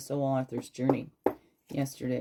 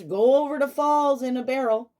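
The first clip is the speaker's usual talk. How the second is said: a higher, pushy tempting voice; the reckless fear talking; 9 semitones higher, 7 dB louder than usual. Acted. The fear urging a trip over the falls, higher voice. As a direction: voice the bad idea, egging you on. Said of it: "the ones that says".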